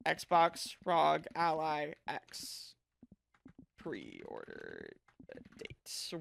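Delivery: dramatic announcer. Pretend. Slow dramatic low voice.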